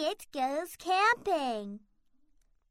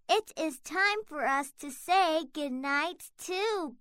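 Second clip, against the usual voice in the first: ordinary delivery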